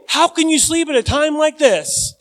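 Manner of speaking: high, loud indignant question; exasperated cry. Retold or imitated. Preacher imagining himself in the boat, exasperated. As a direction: demand it in disbelief. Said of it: "I'd say".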